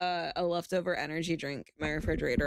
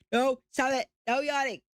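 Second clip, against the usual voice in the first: higher, louder firm no; self-scolding; 6 semitones higher, 3 dB louder than usual. Real, not acted. Streamer telling herself off for yawning. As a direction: order yourself sternly to stop. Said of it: "scolding herself for yawning".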